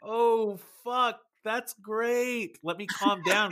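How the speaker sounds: high-pitched stunned relief at the news. Retold or imitated. Husband hearing wife is pregnant, high shocked relief. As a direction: gasp it out, shocked then relieved. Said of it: "And I'm like".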